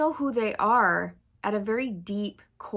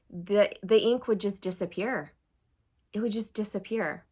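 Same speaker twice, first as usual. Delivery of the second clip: ordinary talk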